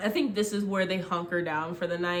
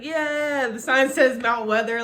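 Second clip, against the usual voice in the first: triumphant cheer; 6 semitones higher, 8 dB louder than usual. Retold or imitated. Jasper triumphant with the sign.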